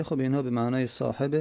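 ordinary talk, nothing marked

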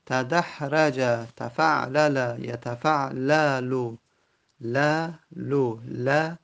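یتفعللو slowed, then ل and لو isolated; slow Arabic forms. Shown as a demonstration. Teacher pointing students to the fatha syllable, slowly. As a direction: break یتفعللو down to its last syllables. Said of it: "teacher isolates the syllable".

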